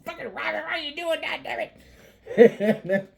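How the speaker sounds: very high, strained put-on voice; hammed-up falsetto complaint; exasperated friend. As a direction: squawk it in mock annoyance. Acts friend's annoyed phone call.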